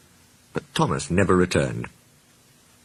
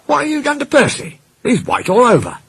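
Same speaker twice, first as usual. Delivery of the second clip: high, startled signalman voice; surprised signalman. A narrator's reading. Signalman's astonished lines.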